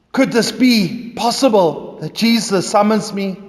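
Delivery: ordinary delivery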